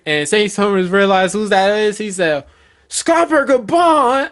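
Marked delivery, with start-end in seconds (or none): 2.9-4.3 high, drawn-out yell of a name; shocked scream. Acted. Saint Summers realizes who attacked him, shocked scream. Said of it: "And he scream".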